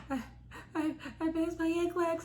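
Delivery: high, halting, slow imitation of crying; tearful, breathless. Crying caller telling sister she passed, tearful halting voice. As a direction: stammer the news out as if sobbing. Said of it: "couldn't catch my breath to tell".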